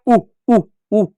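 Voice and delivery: brief, separate repeats of उ; short उ. Shown as a demonstration. Teacher repeating the short vowel for children, short उ. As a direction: say each उ short and distinct. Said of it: "किस रूप में".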